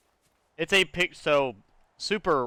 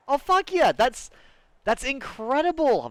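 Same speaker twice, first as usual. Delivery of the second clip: sudden high, loud whoop of joy; excited yell; 13 semitones higher, 4 dB louder than usual. Real, not acted. Streamer cheering a viewer's news, thrilled high yell. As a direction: burst out thrilled at the chat. Excited reaction to chat message.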